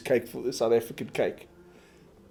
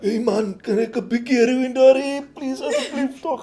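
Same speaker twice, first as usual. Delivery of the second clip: high, hammed-up South African accent; 12 semitones higher, 6 dB louder than usual. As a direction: mimic a South African ordering. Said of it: "South African accents like".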